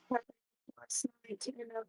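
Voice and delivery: quiet, cutting-out audio; glitchy connection fading out; breaking up on call. Real, not acted. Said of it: "You're breaking up and super quiet".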